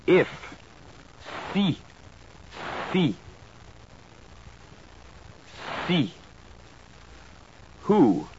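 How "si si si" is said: "Si" with a short pure French i; French pronunciation. Shown as a demonstration. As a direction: model "Si" clearly for the class. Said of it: "followed by the French word twice".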